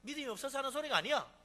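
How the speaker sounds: ordinary delivery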